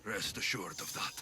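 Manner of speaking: quiet vow. Acted. Vows over dead friend.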